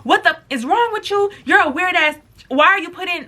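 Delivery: high, mock furious scream at the friend; half-acted outraged yelling; retold screaming. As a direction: yell it like you've caught her red-handed. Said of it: "So I start screaming".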